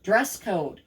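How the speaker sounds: usual speaking voice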